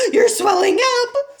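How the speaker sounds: high, loud crying scream; a mother screaming in tears. A narrator's reading. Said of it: "screamed Missus Beauregard again".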